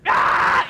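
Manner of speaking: put-on sudden wordless baby scream; loud breathy baby scream; newborn yell. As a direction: yell it right at her face. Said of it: "You just yell at her face".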